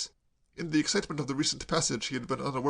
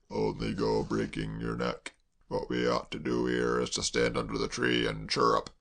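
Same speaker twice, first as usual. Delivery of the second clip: heavy servant voice. A narrator's reading. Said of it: "said Hash heavily".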